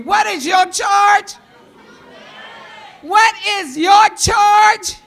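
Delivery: loud, high call to the audience; rally call-and-response shout; calling out to the crowd. Real, not acted.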